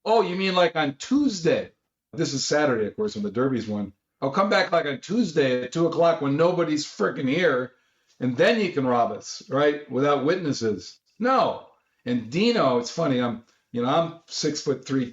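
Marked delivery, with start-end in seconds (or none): 0.0-1.7 high, sarcastic mock yell; incredulous yelling. Retelling yelling at cashier over unpaid winnings, incredulous high mock yell. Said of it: "I'm yelling at him like". 4.2-11.7 high, scornful mock outrage; sarcastic yelling. Retelling confronting cashiers who refuse to pay, high sarcastic mock yell. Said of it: "I'm yelling at him like".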